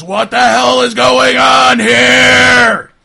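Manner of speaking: very loud, drawn-out shout; big guy's bellow. Acted. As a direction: roar it slowly in outrage. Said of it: "big mottled looking guy goes".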